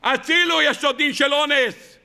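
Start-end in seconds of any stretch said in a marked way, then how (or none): 0.0-0.6 high-pitched mock call for rescue; acted cry for help. Person under attack crying for rescue, acted high cry. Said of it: "ברגע שבן אדם צועק".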